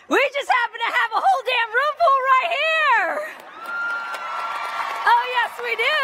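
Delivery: high, loud, fast hype to the crowd; rallying shout. Real, not acted. Commencement speaker hyping graduates in the room, shouting. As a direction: fire up the room.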